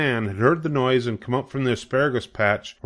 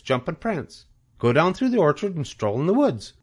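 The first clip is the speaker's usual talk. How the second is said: high, brisk list of urgings; eager bossy goose; 7 semitones higher than usual. A narrator's reading. Goose urging young pig to explore, high and eager. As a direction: egg the listener on, item by item.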